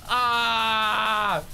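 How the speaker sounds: sharp high cry of pain; startled yell out loud; sudden yelp. Real, not acted. Water fight joke, sudden yelp.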